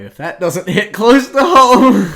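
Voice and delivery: high, loud, slow dramatic wail; mock anguish. Host hit by the guilt line, mock anguish. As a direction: draw it out as if wounded.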